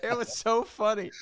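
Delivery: high laughing remark. Real, not acted.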